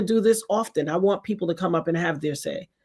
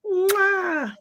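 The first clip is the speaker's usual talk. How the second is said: sweet goodbye; 6 semitones higher, much slower than usual. Real, not acted. Host says sweet goodbye.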